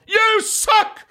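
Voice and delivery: high, loud burst of a yell; re-enacted heckle. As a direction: blurt one big shout at the wrestlers. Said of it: "få en liten sånn".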